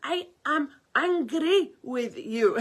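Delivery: slow, loud, deliberate mock declaration; exaggerated stern foreign friend; mimicking the Greek friend. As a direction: lay each word down heavily. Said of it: "He went".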